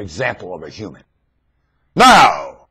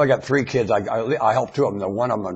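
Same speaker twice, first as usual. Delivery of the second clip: normal talking voice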